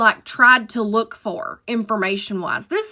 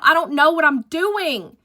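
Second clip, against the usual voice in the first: higher, worried; panicked; 6 semitones higher than usual. Retold or imitated. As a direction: say it in rising panic. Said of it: "And I'm like".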